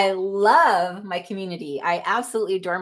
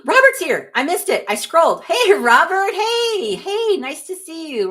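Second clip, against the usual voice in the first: real excited gasp, then high, loud hellos; excited greeting; 8 semitones higher, 6 dB louder than usual. Real, not acted. Streamer spots a viewer they missed, excited greeting. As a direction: greet him high and thrilled.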